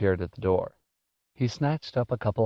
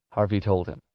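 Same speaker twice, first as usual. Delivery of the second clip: usual speaking voice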